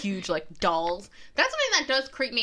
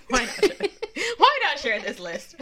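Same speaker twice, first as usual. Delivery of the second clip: breaking into laughter while talking; giggling; 6 semitones higher, 3 dB louder than usual. Real, not acted. Host justifying sharing a list to co-host, giggling. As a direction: laugh through the words, stretching them out.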